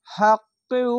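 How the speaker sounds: হাক্কিউ with the qaf joined to the u, said high and clipped; correct pronunciation demo. Shown as a demonstration. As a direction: say হাক্কিউ as one joined syllable chain. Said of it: "এভাবে উচ্চারণ করবেন".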